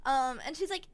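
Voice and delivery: plain reading voice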